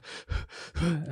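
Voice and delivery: mimicking a panicking dog's breath; imitated dog panting. Podcaster showing how his dog panics, imitated panting. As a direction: huff and pant like a nervous dog. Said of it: "She goes".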